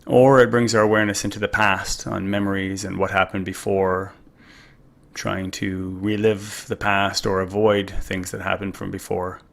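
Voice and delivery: ordinary talk, nothing marked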